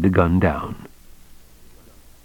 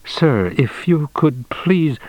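high, slow, halting entreaty; mumbled plea; 7 semitones higher, slower than usual. A narrator's reading. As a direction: plead haltingly, drawing out the words. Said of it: "Childan mumbled".

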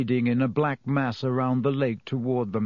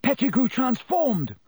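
urgent high-pitched yell; Harry; 10 semitones higher than usual. A narrator's reading. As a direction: yell the alarm to a friend, voice pitched well up. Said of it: "Harry yelled".

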